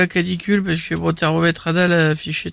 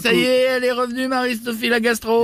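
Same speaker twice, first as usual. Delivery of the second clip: Loana impression; 5 semitones higher than usual. Imitation de Loana. (voix de Loana aiguë et lente)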